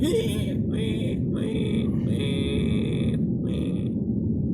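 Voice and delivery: repeated very high-pitched pig squeals; imitated pig squealing. Showing a friend why pigs ruin sleep, high imitated squealing. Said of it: "they do, like, squealing".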